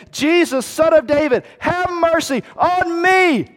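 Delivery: high, pleading shout; crying out. Acted. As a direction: call out for mercy over a crowd. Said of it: "he began to cry out".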